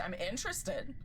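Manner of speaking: ordinary delivery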